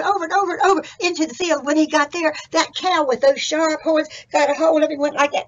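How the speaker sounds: loud, high storytelling at the attack; hollered, breakneck-drama narration; excited story narration. A narrator's reading. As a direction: build the action to a holler. Narrates the chase at full volume.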